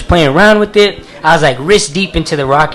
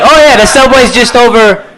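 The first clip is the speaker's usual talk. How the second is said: higher, louder mock helpful pointing; giving directions; 6 semitones higher, 5 dB louder than usual. Comedian giving a stranger crooked directions, mock helpful. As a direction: cheerfully point the wrong way. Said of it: "can't even give reliable directions".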